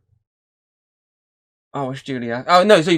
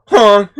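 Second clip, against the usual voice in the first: loud imitated honk; 10 semitones higher, 13 dB louder than usual. Imitating the horn.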